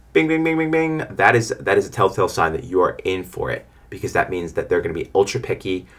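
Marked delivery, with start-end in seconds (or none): 0.1-1.0 high monotone repeated chime; imitated pinging alert; mock alarm bell. Shown as a demonstration. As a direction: ring out like a warning bell. Imitates an alarm for warning sign.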